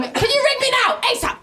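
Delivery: very high, urgent mother voice; imitated mum's message. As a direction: demand a call like an insistent mum. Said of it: "I got this message from her going".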